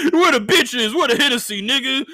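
high, loud caricature of himself; mock rowdy guy. Comic mocking how they painted him, high rowdy caricature. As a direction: ham up the party animal. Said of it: "they making it sound like".

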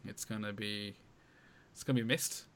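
ordinary talk, nothing marked

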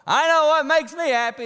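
imitating a loud, high-pitched yell. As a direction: shout it out like a heckler in the crowd. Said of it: "some dude stood up and yelled".

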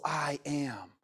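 "I am." whispered low and reverent; "I am." in an awed hushed whisper; solemn whisper. Shown as a demonstration. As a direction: breathe "I am." as the holy name. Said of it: "But it's also".

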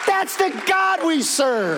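high, loud, slow declaration; loud proclamation. Acted. Preacher proclaiming to the congregation, loud and slow. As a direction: ring it out to the whole church. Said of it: "preacher repeats the refrain".

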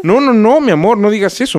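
high, quick protest; eager reassurance. Acted. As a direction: reassure her fast, overdoing it. Hurried reassurance to wife.

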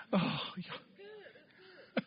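high, soft, breaking cry. Real, not acted. To the congregation, a soft breaking cry. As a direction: let the voice crack up high and soft. Preacher overcome before laughter.

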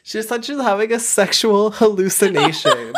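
laughing. Real, not acted. Laughing retelling.

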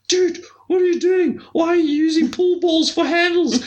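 high mock shouting voice; mock Niels on the phone. Phone call from the mentor, high mock yelling. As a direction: yell at him down the phone, hammed up. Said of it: "He's like".

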